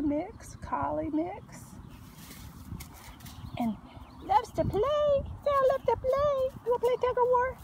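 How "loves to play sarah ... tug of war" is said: high, bouncy coo. Real, not acted. Owner invites the puppy to tug of war in high baby talk. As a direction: tease her into playing, up high.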